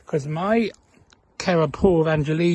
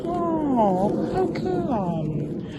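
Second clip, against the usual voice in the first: sad; 6 semitones higher, much slower than usual. Retold or imitated. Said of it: "she got really sad and was like".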